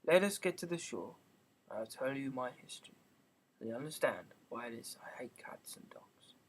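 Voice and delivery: the frightened mouse barely breathes out its words; a hushed, shaky voice sinking into a whisper; quiet trembling voice. A narrator's reading. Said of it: "in a quiet, low, trembling voice".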